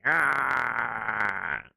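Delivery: long open "aah" in a mock god voice; imitated drawn-out "ah". Streamer showing his sound voice, drawn-out imitation. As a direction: hold an "ah" to mimic the voice. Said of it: "He's got that".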